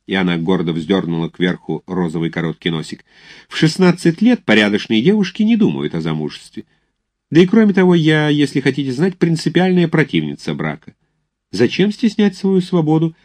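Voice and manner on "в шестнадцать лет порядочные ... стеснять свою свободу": high-pitched, proud schoolgirl voice; haughty young lady, indignant. Acted. Proud girl telling cadet she opposes marriage, haughty. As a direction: protest loftily in a much higher voice.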